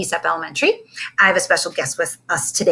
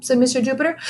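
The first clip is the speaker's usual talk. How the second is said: ordinary delivery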